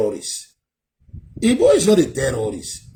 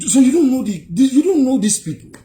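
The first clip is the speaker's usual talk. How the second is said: louder and higher, hammering don't; raised rant; 8 semitones higher, 6 dB louder than usual. Real, not acted. Vlogger scolding followers, loud and exasperated. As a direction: press the word don't with scorn.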